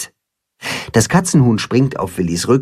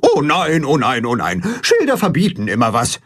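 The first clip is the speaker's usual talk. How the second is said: meckernd; 3 semitones higher than usual. A narrator's reading. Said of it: "meckert Gram kopfschüttelnd".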